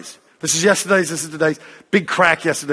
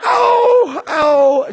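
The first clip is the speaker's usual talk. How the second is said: high, loud mock pain cries of ow; 13 semitones higher, 4 dB louder than usual. Girl with the broken leg walks without crutches, mock pain cries. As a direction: imitate a girl yelping in pain on each step. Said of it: "And, uh".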